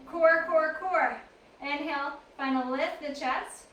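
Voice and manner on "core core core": coaching call. Real, not acted. Coach calling out core work.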